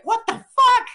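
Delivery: very high, loud burst of disbelief; mock outrage. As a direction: blurt it as a squawked, hammed-up protest. Acting out outrage at pay gap.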